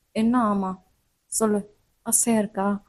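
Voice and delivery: low, soft insistent teasing; insistent professor. Acted. Professor pressing the student, low and teasing. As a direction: press her for an answer.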